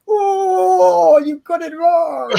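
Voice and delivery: high, loud mock wail; loud heckling. Viewer telling the film it got the Norfolk accent wrong, loud hammed-up protest. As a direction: wail it in over-the-top protest.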